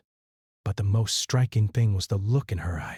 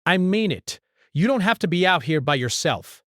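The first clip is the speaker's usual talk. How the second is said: emphatic reassurance; 9 semitones higher, 4 dB louder than usual. A narrator's reading. Man reassuring.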